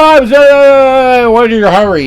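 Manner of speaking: hypothetical hothead reply; mock angry retort. Speaker imagining snapping back at the angry driver, mock indignant shout. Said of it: "I could have been saying".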